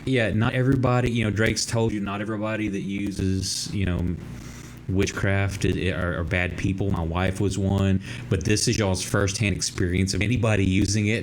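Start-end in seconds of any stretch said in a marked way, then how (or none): none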